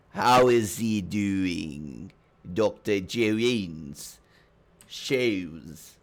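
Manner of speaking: slow, grandly stretched names; terrible boy impression. The boy addresses the rest of the party, slow drawn-out impression. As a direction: draw out every word theatrically. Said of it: "Such a terrible, terrible fucking impression".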